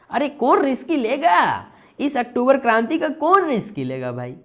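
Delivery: high-pitched, hammed-up protest; protesting prime minister. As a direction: play a leader refusing the risk, pitched high. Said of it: "बोल रहे हैं प्रधानमंत्री जी". (प्रधानमंत्री का नाटकीय, ऊँचे सुर में विरोध)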